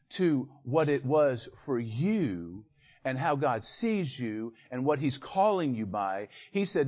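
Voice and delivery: normal talking voice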